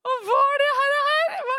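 high, loud bewildered question; astonished. Retold or imitated. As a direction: ask in laughing disbelief. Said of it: "Og vi bare". (høyt og forbløffet spørsmål)

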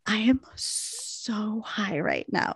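soft whispered confession. Retold or imitated. Leaning over to whisper to Stacy, hushed. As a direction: lean in and whisper it. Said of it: "I lean over to Stacy".

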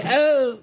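very high, drawn-out "oh"; catchphrase "oh". Acted. Asking co-hosts about Gallagher, high mimicked "oh". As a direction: mimic a comedian's yelped catchphrase. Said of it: "Does he have an".

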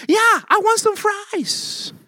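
put-on high, slow, sing-song little boy; sweet toddler voice. Father imitating his toddler son asking for fries, high and slow. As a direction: coax like a toddler who wants fries. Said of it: "And he switches his tone".

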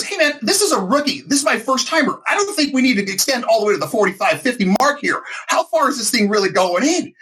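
high, anxious rookie plea; nervous pleading. Retold or imitated. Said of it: "I'm about to say to the nurse".

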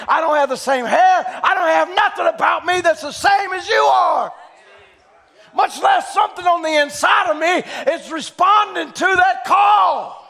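loud, high-pitched sermon delivery; full-force preaching. Real, not acted. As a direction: preach it out to the whole congregation.

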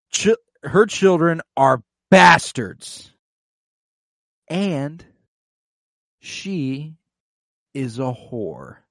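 big and loud, then slowing and dropping; retold accusation, drawn out for effect; dramatic declaration. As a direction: proclaim it with long pauses between words. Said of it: "he ends with the declaration".

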